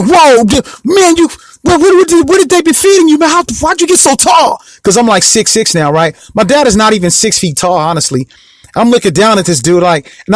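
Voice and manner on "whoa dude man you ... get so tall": high-pitched imitation of astonishment; amazed dad. Dad marvelling at his towering son, amazed. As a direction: act out a dad's disbelief, voice pitched way up.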